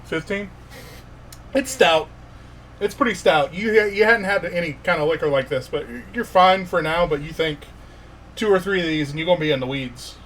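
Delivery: usual speaking voice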